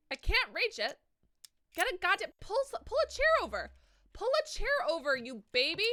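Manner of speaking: loud, high-pitched scolding; high, loud playful berating; exasperated yelling. Pretend. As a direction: yell at the game character in mock exasperation. Scolding game character who can't reach.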